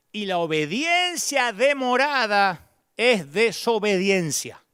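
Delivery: ordinary talk, nothing marked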